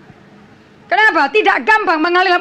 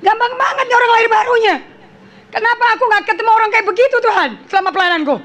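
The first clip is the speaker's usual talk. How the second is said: higher, amazed disbelief; retold amazement; 5 semitones higher than usual. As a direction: marvel at how easy it was. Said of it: "Dan saya bilang". (heran dan takjub, suara lebih tinggi)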